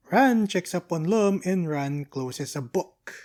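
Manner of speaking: usual speaking voice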